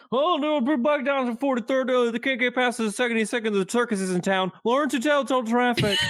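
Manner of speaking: hammed-up unintelligible radio traffic lady; mumbled traffic report. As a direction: mumble the traffic report so it's hard to follow. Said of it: "you cannot understand a single word".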